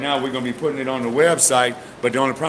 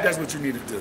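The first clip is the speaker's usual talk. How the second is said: ordinary talk, nothing marked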